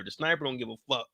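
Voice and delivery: usual speaking voice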